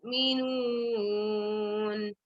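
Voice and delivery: recitation in one breath. Shown as a demonstration. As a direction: carry the whole ayah on a single breath. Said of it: "I'll read for those who have good breath".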